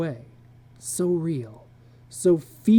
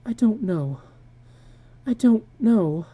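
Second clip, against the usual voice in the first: tearful; 7 semitones higher than usual. Acted. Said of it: "blowing her nose".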